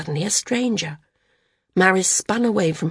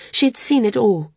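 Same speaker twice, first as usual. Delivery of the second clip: ordinary delivery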